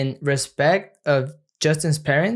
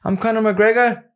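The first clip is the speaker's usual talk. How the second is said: Irish accent; 7 semitones higher, 5 dB louder than usual. Shown as a demonstration. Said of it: "give me time to transform".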